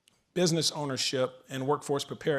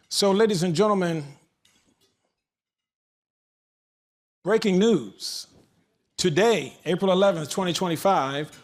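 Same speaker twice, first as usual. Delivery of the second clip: raised, deliberate announcer delivery; breaking-news announcement; 2 semitones higher, 3 dB louder than usual. Real, not acted. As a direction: proclaim a big launch to the room, stretching key words. Ceremonial launch announced as breaking news.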